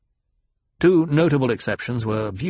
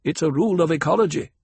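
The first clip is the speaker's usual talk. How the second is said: quick, slightly pitched-up expert aside; lightly voiced ecologist; 6 semitones higher, 4 dB louder, faster than usual. Ecologist backs the young master's point, lightly authoritative. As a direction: step in briskly as the expert.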